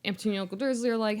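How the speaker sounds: normal talking voice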